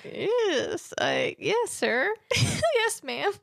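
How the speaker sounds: annoyed but forced polite; through gritted teeth. Shown as a demonstration. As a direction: grit your teeth and stay polite. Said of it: "talking through my teeth because I'm so annoyed".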